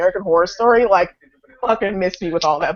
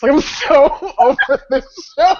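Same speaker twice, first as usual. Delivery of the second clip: laughing exasperation; 5 semitones higher, 4 dB louder, slower than usual. Real, not acted. Venting about the show.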